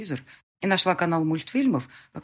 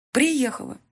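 firm; 9 semitones higher, 4 dB louder, slower than usual. Acted. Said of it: "твёрдо сказала я".

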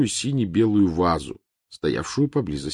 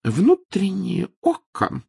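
dignified; 13 semitones higher, 4 dB louder, much slower than usual. A narrator's reading. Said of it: "с достоинством сообщила".

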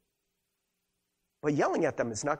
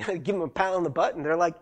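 usual speaking voice

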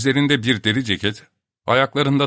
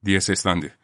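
usual speaking voice

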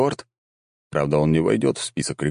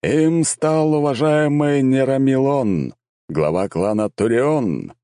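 solemn herald; 5 semitones higher than usual. A narrator's reading. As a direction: hail the champion to the crowd. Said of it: "торжественно провозгласил глашатай".

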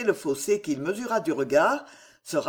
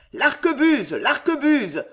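high, raised cry for a weapon; vociferating; 7 semitones higher, 5 dB louder than usual. A narrator's reading. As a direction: shout the order out, pitched well up. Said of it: "vociféra le duc d'Anjou". (vociférer d'une voix aiguë)

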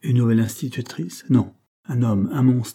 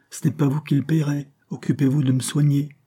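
indignant retort; 6 semitones higher, 3 dB louder than usual. A narrator's reading. Acted retort to a prying servant.

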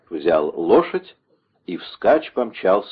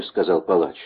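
ordinary delivery, nothing marked

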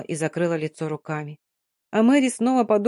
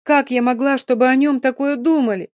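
the same question, cried out higher and louder; anguished outcry; 5 semitones higher, 6 dB louder than usual. Acted. Dina cries out her guilt to Mary, anguished. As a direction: burst out in despair.

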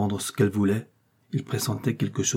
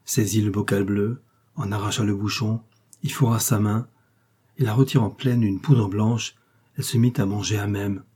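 usual speaking voice

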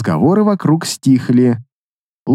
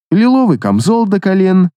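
ordinary delivery, nothing marked

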